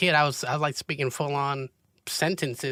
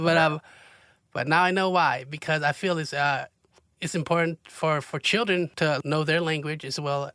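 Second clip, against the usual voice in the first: normal talking voice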